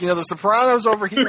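high, helpful mock voice pointing the way; lightly voiced choir leader. Mocking the choir leader to co-hosts, high mock voice. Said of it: "And he's like".

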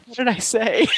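high, laughing imitation of the puzzled actor; laughing mock confusion; laughing quote. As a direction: blurt it out through laughter. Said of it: "he was like".

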